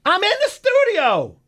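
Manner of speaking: high, drawn-out, loud protest; exasperated shout. Real, not acted. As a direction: yell it back to insist, each word stretched. Rebutting colleague who says he isn't in.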